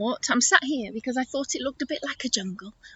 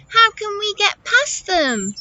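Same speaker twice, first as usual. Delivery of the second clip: high attempt at an elephant; poor elephant voice; 10 semitones higher, 3 dB louder, slower than usual. Storyteller's failed elephant voice asking about the rocks, high. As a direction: ask it high, missing the elephant sound. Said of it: "that wasn't a very good elephant voice".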